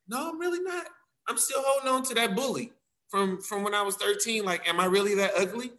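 high, small hurt voice; acted vulnerable confession. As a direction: voice a wounded inner child, lightly acted. Voices hurt inner self answering.

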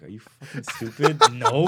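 drawn-out mock disbelief, ending loud; mock indignation. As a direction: imitate an offended shopkeeper. Retold baker scolds him, offended.

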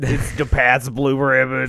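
slow Boston drawl on Pats; Boston accent. Pretend.

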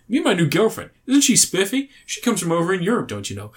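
high, swooping mock-American patter; American accent. American guy introducing his new girlfriend, hammed-up American accent. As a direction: imitate a cheery American, pitch dropping away at the end. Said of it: "he plays an American guy".

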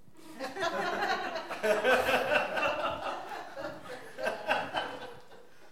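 real high airy laugh at Siri; high breathy laugh. Real, not acted. Speaker laughs as Siri interrupts, high airy laugh.

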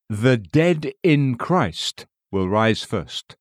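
very slow, each word weighed; emphasis added. Shown as a demonstration. Preacher quoting scripture to readers, very slow emphasis. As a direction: draw out "The dead in Christ" deliberately. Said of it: "Emphasis added".

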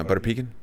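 pecan said as "PEE-kun", rising as a question; mispronounced pecan. Retold or imitated. Guest asks friends for ice cream, pecan as "PEE-kun". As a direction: ask with pecan stressed on PEE. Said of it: "I thought it was".

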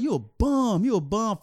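high, loud jeering chant; crowd taunting. Retold or imitated. Bystanders jeering at the cop, loud high taunt. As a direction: jeer it at the cop. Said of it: "Then they start saying".

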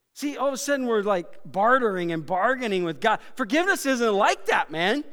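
high, loud, driving sermon climax; full-force preaching. Real, not acted. Preacher pressing the congregation on God's lavish forgiveness, booming and high. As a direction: preach it out with everything.

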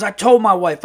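mock-emphatic brash storyteller; Earl. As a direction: imitate a brash guy sounding off about his wife. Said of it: "like, a very brash uncle".

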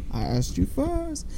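high, slow, soft repeat; imitated echo. Fan plays the echo mimicking the retort, soft and high. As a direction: echo it back faintly from afar.

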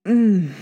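short loud male grunt. Acted. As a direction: grunt with release. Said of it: "Rovan grunted".

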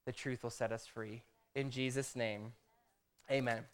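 low, earnest prayer voice. Acted. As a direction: congregation prayer, hushed closing. Opening prayer before sermon.

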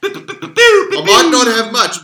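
slow, high, hammed-up declaration; drawn-out mock pronouncement; mock dramatic.

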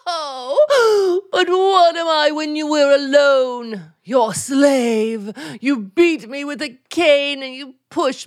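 melodramatic sobbing accusation; hammed-up tearful outburst from a wronged wife; mock wailing martyr. Wife accusing husband before his friend, in mock wailing.

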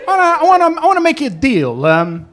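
husband on the phone buttering up the cab driver; smooth-talking husband. Retold or imitated. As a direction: schmooze the stranger like a fixer. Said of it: "he says".

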